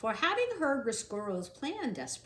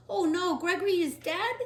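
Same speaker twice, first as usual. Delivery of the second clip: shocked; 7 semitones higher, 5 dB louder than usual. Acted. Shocked mouse hears news.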